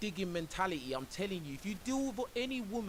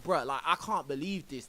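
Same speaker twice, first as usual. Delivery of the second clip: ordinary talk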